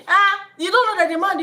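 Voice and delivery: ordinary delivery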